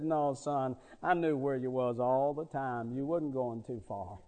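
low, hushed grandfatherly comfort; retold gentle reassurance, voice dropped low; tender reassurance. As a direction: soothe a scared child, soft and deep.

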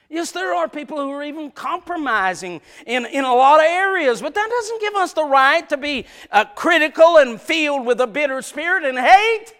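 building suspense from the pulpit; full-force preaching. Acted. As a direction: drive the story like a revival preacher.

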